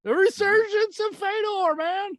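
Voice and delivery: high, announcer-style hype. Acted. Hyping Fedor's comeback to his co-host in an announcer voice. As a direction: proclaim it like a fight trailer.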